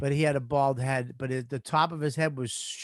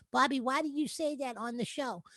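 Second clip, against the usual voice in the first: high, soft mock voice for a big guy; Mike Tyson impression; 8 semitones higher, 8 dB quieter than usual. Comic imitating his big friend scolding him, high soft Mike Tyson voice. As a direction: put on a small, high-pitched voice as the offended friend. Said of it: "you gave him Mike Tyson's voice".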